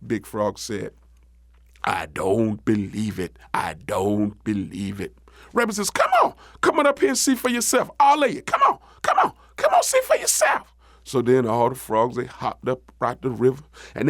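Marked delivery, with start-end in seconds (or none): 1.8-5.2 low, slow bullfrog voice; deep, skeptical frog; doubting big frog. Acted. 5.9-10.7 high, excited rabbit call; insistent, pitched-up urging. Acted. Rabbit urges frogs to come see.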